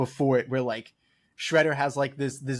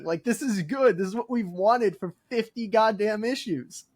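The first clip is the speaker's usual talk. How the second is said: much higher, half-laughing exclamation of disbelief; mock overwhelmed fan; 8 semitones higher, 3 dB louder than usual. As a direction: ham up being moved to tears by a villain's death. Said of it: "And it's like".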